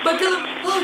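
ordinary delivery

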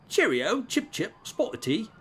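high-pitched hammed-up British accent; mock British accent. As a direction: play a squeaky caricature Brit. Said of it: "And traditional other British idioms".

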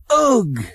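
high, sharp cry of disgust; a high yelp of disgust at the mess; disgusted ugh. A narrator's reading. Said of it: "he exclaimed".

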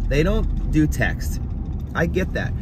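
ordinary delivery

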